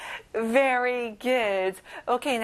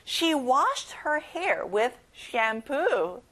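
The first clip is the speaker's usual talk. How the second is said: very slow with clear "shampoo"; slow careful read; much slower than usual. Shown as a demonstration. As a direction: read slowly, nail "shampoo". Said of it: "샴푸 발음 잘해보세요".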